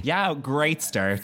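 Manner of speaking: slow, dry sarcasm; deadpan it slowly; sarcastic. Real, not acted. Sarcastic reply about a controlling ex.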